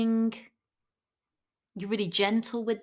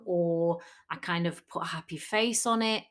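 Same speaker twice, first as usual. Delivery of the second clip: ordinary delivery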